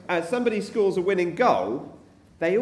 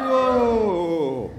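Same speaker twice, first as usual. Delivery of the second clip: long, falling crowd-style whoa; Jerusalem wave. Shown as a demonstration. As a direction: draw the cheer out as the wave passes. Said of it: "here's what a Jerusalem wave".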